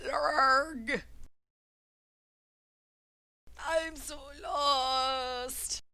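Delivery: high, slow wailing creature. Acted. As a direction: stretch the lost wail out. Creature wailing it's lost.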